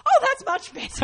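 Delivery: very high, loud sarcastic retort; mock-outraged sarcasm. Offended performer answers the boomerang excuse, sarcastic outrage. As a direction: throw it back with exaggerated indignation.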